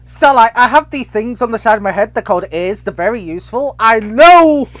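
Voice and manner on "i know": slow, drawn-out, pointed retort; exasperated emphasis. Real, not acted. Player telling Starlight they have ears, exasperated. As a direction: spell it out slowly, exasperated.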